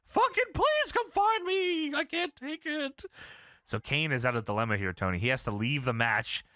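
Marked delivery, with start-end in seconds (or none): 0.1-3.1 put-on high, strained old-man squeak; hammed-up high Paul Bearer whine; squeaky Paul Bearer voice. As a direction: squeal it like a pathetic old ghoul. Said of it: "Paul Bear says".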